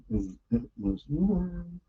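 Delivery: slow, soft metered "It was warm"; reading in meter. Shown as a demonstration. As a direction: read "It was warm" slowly, tapping the meter. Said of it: "I tried to write iambic pentameter".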